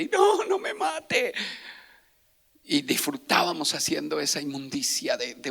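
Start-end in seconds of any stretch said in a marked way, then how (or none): 0.1-1.3 high, drawn-out mock plea; imitated pleading cry. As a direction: imitate a terrified victim begging for his life. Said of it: "Y él me gritaba". (imitando un grito de súplica, agudo y alargado)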